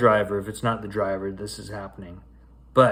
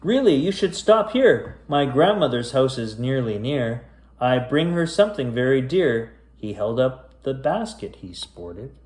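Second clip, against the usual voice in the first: spooky rhyming storybook read; story narration; slower than usual. A narrator's reading. Reading a creepy rhyming tale to kids, storyteller voice. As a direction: read it aloud like a bedtime ghost tale. Reads a spooky rhyme aloud.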